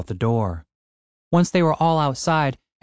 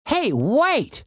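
squeaky, much higher protest; high-pitched cry; 13 semitones higher than usual. A narrator's reading. Tim grabbed by the minotaur, high cry. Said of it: "cried Tim".

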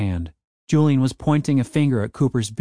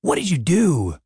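higher-pitched, alarmed demand; mild shout; 5 semitones higher than usual. A narrator's reading. Dave demands answers from Cooper, mild shout. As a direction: raise the pitch in a contained shout. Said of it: "shouted Dave".

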